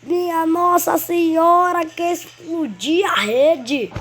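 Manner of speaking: high, slow, screaming goal call; explosive goal commentary. Acted.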